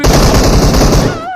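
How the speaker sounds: loud, high, drawn-out yell; real high-pitched gamer outburst; outraged shout. Real, not acted. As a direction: shout it in disbelief, stretching it out. Outraged at enemy lying before him.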